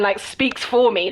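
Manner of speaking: ordinary delivery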